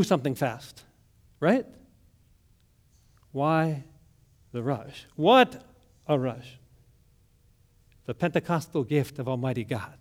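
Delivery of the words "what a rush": ordinary delivery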